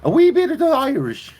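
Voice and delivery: put-on high, loud stage Irish accent. Streamer showing his Scottish impression slips into Irish accent. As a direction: ham up an Irish brogue for a wee bit. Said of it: "try to do a Scottish impersonation, I always end up going Irish".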